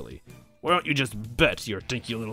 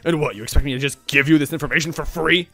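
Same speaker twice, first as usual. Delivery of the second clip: indignant demand, higher and louder; affronted boss, raised voice; indignant villain; 5 semitones higher, 7 dB louder than usual. A narrator's reading. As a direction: bristle at being asked for a favor. Bowser angered, demanding payment.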